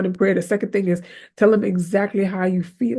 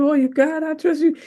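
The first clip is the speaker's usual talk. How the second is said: higher, sing-song fake piety; mock a phony "I trust you"; 7 semitones higher than usual. Preacher mocking a churchgoer's fake trust in God, higher and sing-song. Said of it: "So you come and".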